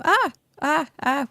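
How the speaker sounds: short loud frightened cries; startled yelps. Real, not acted. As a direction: yelp in real fright, three times. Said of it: "It scared me".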